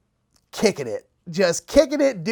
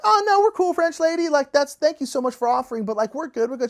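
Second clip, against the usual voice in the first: high-pitched, overly polite decline; mock-friendly, hurried no-thanks; polite refusal; 7 semitones higher, faster than usual. Group politely declines her offer.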